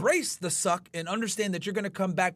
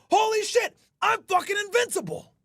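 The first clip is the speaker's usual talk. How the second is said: much higher and louder, roaring invincible self-talk; triumphant shout; 11 semitones higher, 4 dB louder, slower than usual. Retold or imitated. Streamer telling viewers his gym mindset, roaring triumphant shout. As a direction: shout it like you can't be stopped. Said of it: "then you realize".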